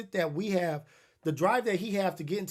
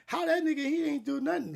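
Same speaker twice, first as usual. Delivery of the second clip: amazed onlookers; 9 semitones higher than usual. Retold or imitated. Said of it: "people be like".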